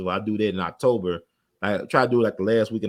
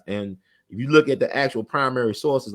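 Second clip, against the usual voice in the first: ordinary delivery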